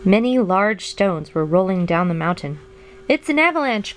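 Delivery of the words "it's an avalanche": high-pitched cry of warning; alarmed cry. A narrator's reading. Toad warns Frog of falling stones, alarmed cry. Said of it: "cried Toad".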